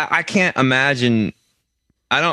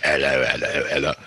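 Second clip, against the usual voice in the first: much lower British caricature; low mock British accent; 8 semitones lower, 3 dB quieter, faster than usual. Echoing co-host's British greeting, low mock British accent. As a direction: echo it deep in mock British.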